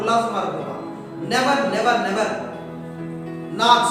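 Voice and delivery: normal talking voice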